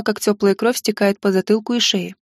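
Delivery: plain reading voice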